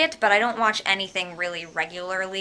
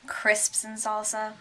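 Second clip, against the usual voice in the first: ordinary talk, nothing marked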